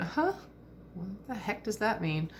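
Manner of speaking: mock baffled "Huh?" jumping way up high; confused young person. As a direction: playfully imitate a puzzled teenager. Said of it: "you go".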